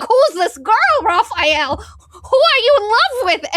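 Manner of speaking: high, loud, climbing questions; mock nosy questioning. Everybody asking Raphael about his secret love, exaggeratedly nosy. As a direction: play a whole group prying into a friend's crush.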